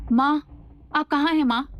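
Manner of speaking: anxious calling. A narrator's reading. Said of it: "चेहरे पर परेशानी के भाव लिए कहती है".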